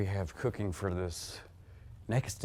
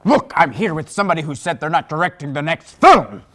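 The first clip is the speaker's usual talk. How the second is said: higher, much louder, tattling over the phone; loud outburst; 7 semitones higher, 16 dB louder than usual. Acted. Actor loudly tells the caller the director quit, higher and louder. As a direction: blurt it out at the top of your voice.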